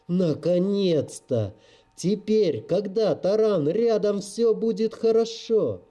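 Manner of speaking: relieved joy. Acted. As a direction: burst out in relieved delight. Girl overjoyed her rescuer arrived.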